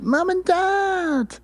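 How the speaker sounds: put-on very high, slow childlike cry; a small doll calling for its parents; Glenn's little voice. Doll finding his parents, high and drawn out. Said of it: "and he's like".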